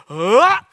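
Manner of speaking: loud high ah; mock baby cry. Pastor imitating his baby going for the table. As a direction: act out the baby's lunge.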